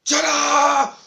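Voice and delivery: very loud, high drawn-out scream; full-force high wail; child's scream. Acted. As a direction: scream out for daddy like a terrified kid.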